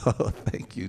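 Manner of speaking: usual speaking voice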